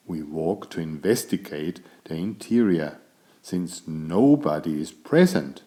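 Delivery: usual speaking voice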